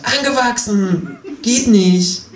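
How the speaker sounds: high, slow, annoyed kangaroo; fed-up kangaroo insists; overly distinct and annoyed. A narrator's reading. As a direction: spell it out with irritation. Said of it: "sagt überdeutlich und genervt".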